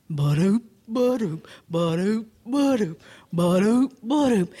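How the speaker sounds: rhythmic lumbering footstep noises; sauntering bear. Acted. Bear sauntering along, sung-out footsteps.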